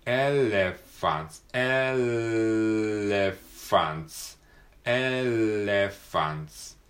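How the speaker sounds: "elephants" very slow, the L held long against the teeth; stretched L. Shown as a demonstration. Teacher drills "elephants" slowly, lingering on the L. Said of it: "ElephantsのLの部分".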